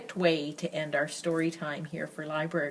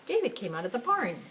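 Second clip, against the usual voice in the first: plain reading voice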